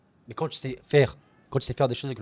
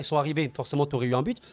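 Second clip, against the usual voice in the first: usual speaking voice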